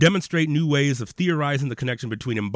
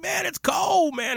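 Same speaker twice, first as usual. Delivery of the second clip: a much higher, whiny mock complaint about the cold; Californian cold complaint; slower than usual. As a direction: squeal it like a Californian who thinks fifty is freezing. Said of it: "people there in California were like".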